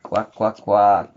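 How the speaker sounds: quacking, last one loud; duck quacks. Shown as a demonstration. Imitates duck for child.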